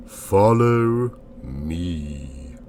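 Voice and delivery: very slow, drawn-out mysterious summons; sonorous, authoritative, reassuring. A narrator's reading. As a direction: intone it with calm authority, stretching each word. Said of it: "in tones sonorous, authoritative, and yet strangely reassuring".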